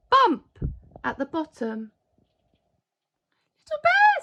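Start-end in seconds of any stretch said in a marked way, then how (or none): 3.6-4.2 high, loud friendly call. Acted. Father Christmas greets the fallen bear with a friendly call. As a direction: sing out his name cheerfully. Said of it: "said a friendly voice".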